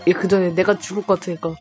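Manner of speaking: ordinary talk, nothing marked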